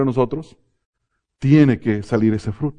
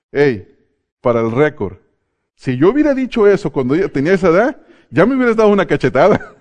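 loud, high, rapid mock-indignant protest; playful outburst, pitched up and hurried; son's mock protest; 4 semitones higher, 5 dB louder than usual. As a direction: imitate an aggrieved son objecting.

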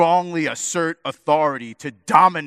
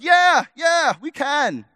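high, loud eager yell; eager shout; 12 semitones higher, 5 dB louder, slower than usual. Retold or imitated. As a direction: blurt it like overeager disciples. Eager disciples answer Jesus.

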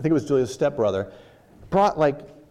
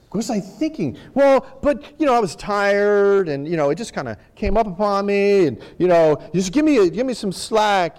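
whiny excuses; 6 semitones higher, 4 dB louder than usual. Retold or imitated. Excuses after giving in to temptation.

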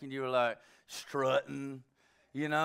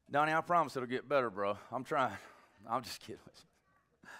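usual speaking voice

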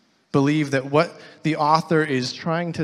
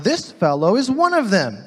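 higher, louder pointed accusation; accusing servant girl; 8 semitones higher, 8 dB louder than usual. Acted. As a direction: call him out to the bystanders. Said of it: "she said again to those standing around".

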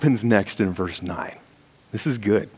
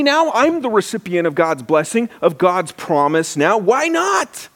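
much higher, rising wonder at finding the well; excited discovery; 10 semitones higher than usual. Retold or imitated. Jacob realising at the well, higher and thrilled. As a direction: gasp it out as if God's plan just clicked.

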